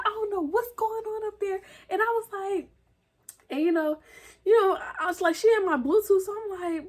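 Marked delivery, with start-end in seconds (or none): 0.1-1.6 worried mom. Retold or imitated. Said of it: "She said".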